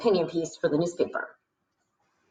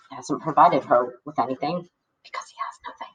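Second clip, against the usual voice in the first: whispered aside; 7 semitones lower, 3 dB quieter than usual. Real, not acted. Reader telling listeners Arthur has nothing, whispered.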